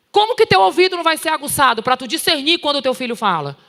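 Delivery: ordinary talk, nothing marked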